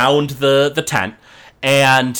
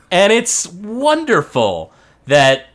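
ordinary delivery

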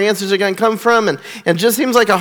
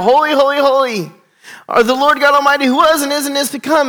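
exuberant proclamation; 6 semitones higher, faster than usual. Acted. Preacher voicing creation's endless praise, high and exuberant. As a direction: burst out the holies in praise. Creation's cry voiced with rising energy.

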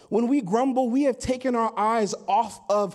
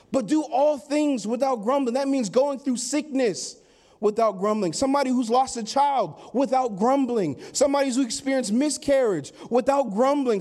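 higher, driving sermon delivery; forceful preaching; 5 semitones higher, 4 dB louder than usual. Real, not acted. Preacher lists hardships endured without grumbling, forceful preaching. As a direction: hammer each example home to the congregation.

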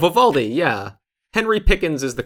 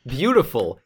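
ordinary talk

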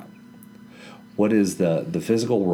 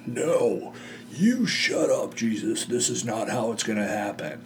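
much higher, slow mock scolding; mock Peter rebuking; 4 semitones higher, slower than usual. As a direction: squeak out a cheeky retort at Jesus. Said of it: "when Peter was rebuking him".

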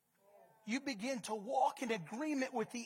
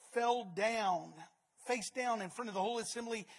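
ordinary talk

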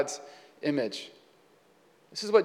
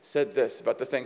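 put-on low, soft, subdued older gentleman; Dallas Willard; 6 semitones lower, 7 dB quieter than usual. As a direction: talk like a mild, unexciting elderly theologian. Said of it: "he kind of talked like this".

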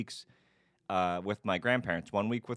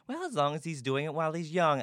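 high-pitched aunt voice; aunt's aside; 7 semitones higher than usual. Retold or imitated. Aunt remarking to mom about her nephew, high imitated voice. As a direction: imitate a tolerant aunt confiding upward in pitch. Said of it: "she'll turn to my mom".

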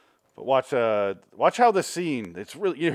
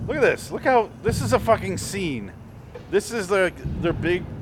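excited; 7 semitones higher than usual. Real, not acted. Excited pointing at scene.

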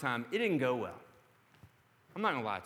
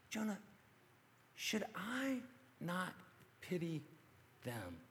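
soft appeal; 3 semitones higher, 11 dB quieter than usual. Acted. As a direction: ask it gently, almost under the breath.

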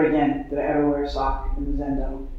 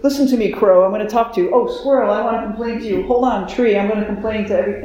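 mock complaining to the animals; 4 semitones higher, faster than usual. Telling the crow, squirrel and tree her woes, mock whiny. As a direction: whine at the crow and squirrel like a spoiled kid. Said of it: "I was very much a complainer".

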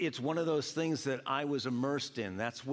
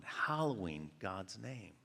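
ordinary talk, nothing marked

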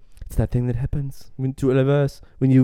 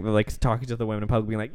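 normal talking voice

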